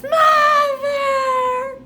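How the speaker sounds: very high, loud drawn-out call; a child yelling downstairs for mother. Acted.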